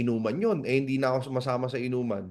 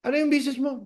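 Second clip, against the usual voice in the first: much higher, straining question; exasperated asking; 10 semitones higher, much faster than usual. Retold or imitated. Friend asking the shy recruit once more, exasperated. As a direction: ask once more, losing patience. Friend asks yet again.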